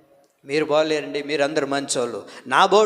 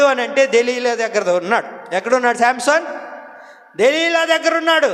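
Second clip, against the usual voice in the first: raised-voice gospel preaching; preaching at full force; 8 semitones higher than usual. Acted. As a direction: drive the sermon home from the pulpit.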